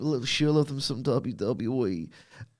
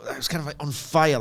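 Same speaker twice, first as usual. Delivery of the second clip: ordinary talk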